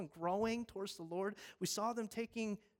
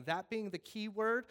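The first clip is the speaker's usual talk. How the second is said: ordinary delivery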